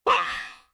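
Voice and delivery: small voiceless gasp; mother's startled little intake of breath. A narrator's reading. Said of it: "made a little gasping sound".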